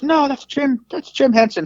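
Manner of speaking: high imitated interviewer, insisting; exasperated correction. Interviewer corrects him once more.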